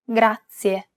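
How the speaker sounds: "Grazie" with a tapped R, GRAH-tsee-eh; rolled R. Shown as a demonstration. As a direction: roll the R in "Grazie". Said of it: "flip your tongue up and back".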